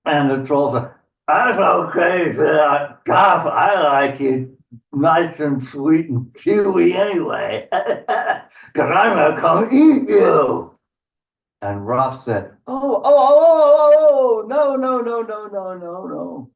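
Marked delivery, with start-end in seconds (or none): 1.3-10.8 raised, slow troll voice with a chuckle; gloating hungry troll. Acted. 12.7-16.5 high wail that dies away; panicked goat. Acted. Goat panics at threat of being eaten.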